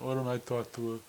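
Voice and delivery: a hint of a thick-headed tough man accent; Johnny's tough brain-dead man accent. Host imitates the new guy, tough man accent. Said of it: "dead man accent".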